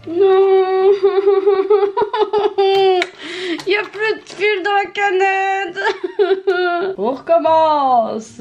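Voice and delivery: high, loud, comic wail; despairing wail. Real, not acted. Sewer finding the bobbin empty, wailing in dismay. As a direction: wail in dismay, half laughing. Bobbin thread has run out.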